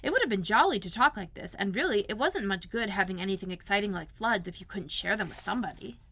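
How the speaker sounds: ordinary delivery, nothing marked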